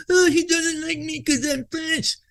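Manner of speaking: high, slow mocking whine of a self-pitying coworker; whiny victim voice. Mocking the coworker who plays the victim, high whiny voice. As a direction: mimic him playing the victim, high and drawn out. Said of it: "he would be the biggest victim".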